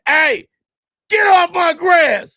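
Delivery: high, loud, slow shout to get off the lawn; old neighbor yelling at a kid on his grass; grumpy old man yelling. Retold or imitated. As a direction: bark at the kid like a cranky neighbor. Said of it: "And he said".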